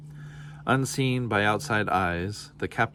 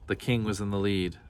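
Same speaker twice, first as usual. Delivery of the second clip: plain reading voice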